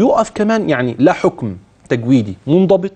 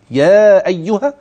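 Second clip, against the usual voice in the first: يا held for four counts, louder; four-count madd; 5 semitones higher, 4 dB louder, slower than usual. Shown as a demonstration. Sheikh showing the separated madd, held. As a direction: stretch يا four beats. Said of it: "وهمد أربعة".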